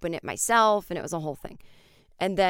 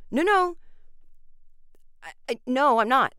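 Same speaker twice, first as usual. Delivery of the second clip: flustered protest; 6 semitones higher, 4 dB louder than usual. Retold or imitated. Protesting you'll change your mind.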